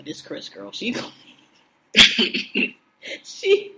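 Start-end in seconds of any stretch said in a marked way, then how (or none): 1.9-3.7 laughing. Real, not acted. Laughing mid-sentence.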